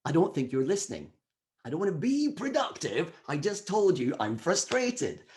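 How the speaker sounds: like your brain rolling its eyes at you; exasperated brain. Pretend. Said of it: "It might go".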